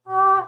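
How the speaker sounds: high camel bleat; imitated camel cry. Streamer insisting camels do go like this, camel cry. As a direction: call out like a camel. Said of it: "I think they do go like".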